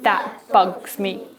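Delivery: slow, drawn-out grouchy grumble; grumpy letter U. Acted. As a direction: drag it out like a sulky tough guy. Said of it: "Will you do it like he did?".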